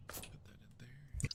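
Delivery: unvoiced murmur to self; whisper. Real, not acted. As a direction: whisper it under your breath. Whispered aside while working.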